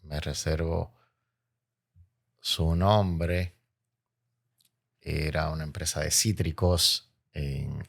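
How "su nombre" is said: ordinary delivery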